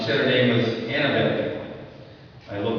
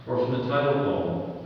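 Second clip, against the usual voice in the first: ordinary talk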